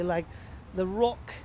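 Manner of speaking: ordinary talk, nothing marked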